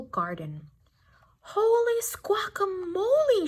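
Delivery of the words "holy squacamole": breathy whispered exclamation; hushed hen's cluck. A narrator's reading. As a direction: whisper it like a startled hen. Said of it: "she clucked".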